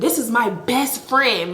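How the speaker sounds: slow, deliberate weight on "best friend"; stress on best friend. Retold or imitated. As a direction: lean hard on "best friend", drawing the line out. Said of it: "I'm putting be-, emphasis on best friend".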